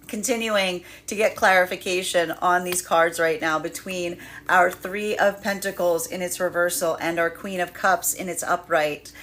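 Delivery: ordinary talk, nothing marked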